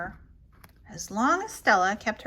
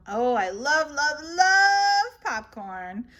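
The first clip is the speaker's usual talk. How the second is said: gushing; 2 semitones higher, 5 dB louder, slower than usual. Acted. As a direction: swoon over the popcorn. Gushing over snacks.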